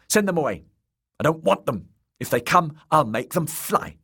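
put-on high, childish order; high-voiced little lord's tantrum; petulant boy. A narrator's reading. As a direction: command them off like a spoilt child. Boy lord rejects the coming visitors.

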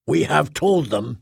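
plain reading voice

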